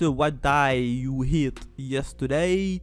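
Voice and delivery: slow, drawn-out title in a put-on English accent; hamming up an English accent on purpose; mock English accent. Reading a manga title aloud in a jokey English accent. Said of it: "avec mon magnifique accent anglais".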